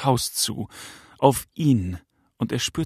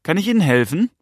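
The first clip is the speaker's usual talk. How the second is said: higher, raised questioning call; 6 semitones higher, 4 dB louder, faster than usual. A narrator's reading. Father to the silent approaching man, calling out. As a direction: call the offer out across the distance. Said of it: "rief er".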